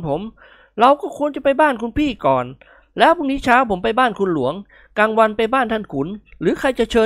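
Nikon mediating. Acted. Cheerful guest sharing out meals among rival hosts, mediating. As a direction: lay out the schedule persuasively.

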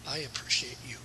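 soft whisper, low and close; whispered tenderness. Acted. Preacher voicing God whispering to his children. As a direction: breathe it like a father comforting a child.

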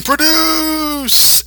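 high mock yell; hammed-up high yell. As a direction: belt it out like a ranting villain. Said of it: "they start yelling".

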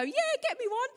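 put-on very high caricatured accent; imitated high-pitched reply from another room; put-on girlfriend voice. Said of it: "why I did that voice".

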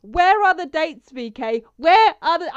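yelling in frustration; screaming. Retold or imitated. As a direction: scream it out, frantic. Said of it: "you should have heard me yesterday screaming".

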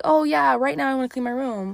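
high, loud, sing-song mock enthusiasm; mock eager. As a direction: chirp it like a fake keen student.

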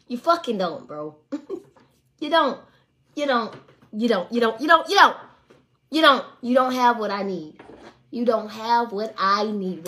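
loud, hammering repeated you don't; emphatic yelling. Retold or imitated. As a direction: pound every line loudly. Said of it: "I done cussed his ass out".

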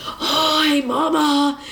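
playfully breathed-out re-enacted hello; breathy greeting. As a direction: breathe the words out as if fogging glass. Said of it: "like, breathing".

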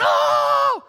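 long high loud whoop; hyped bench cry. Retold or imitated. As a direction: erupt like fans at a dunk. Disciples reacting like a bench.

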